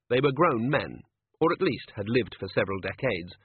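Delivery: ordinary delivery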